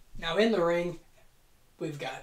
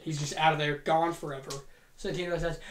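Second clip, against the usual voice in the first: ordinary talk, nothing marked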